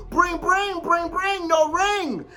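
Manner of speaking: phone ring imitation. Mimicking an unanswered phone call.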